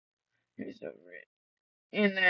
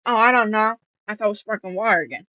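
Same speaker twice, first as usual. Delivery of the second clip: mocking her; 4 semitones higher, 6 dB louder, much faster than usual. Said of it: "she was like".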